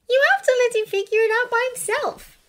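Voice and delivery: put-on high-pitched, exasperated girl's voice; exasperated sigh. A narrator's reading. Hermione advising Draco on Harry's egg, exasperated. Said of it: "Hermione sighed".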